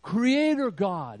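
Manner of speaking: slow booming exalted title; grand invocation. Retold or imitated. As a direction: call it out like a solemn title.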